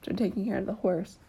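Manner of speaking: usual speaking voice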